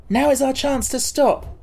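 put-on boy's voice. A narrator's reading. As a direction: voice the boy urgently. Boy calls out to stop.

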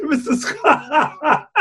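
high, slow, laughing Mister Scott; host breaking up while voicing the captain, laughing. Laughing while calling the engineer.

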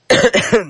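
a short real cough. Real, not acted. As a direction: cough once, dry and sudden. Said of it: "scusatemi ancora la tosse".

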